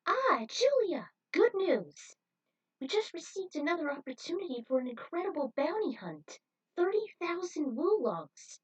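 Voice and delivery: high and loud, bursting with good news; excited. Acted. Spike eagerly telling Julia about the bounty, excited and high. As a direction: stretch her name out, thrilled to see her.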